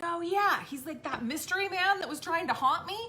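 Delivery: put-on high, loud, upbeat kid voice; matter-of-fact child. Son recalling the mystery man, unfazed.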